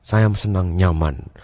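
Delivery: low, slow speech with pauses; unhurried, soft, low demo voice; feeling-type speaker. Acted. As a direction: linger on each phrase, low and soft. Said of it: "dia menggunakan pilihan katanya".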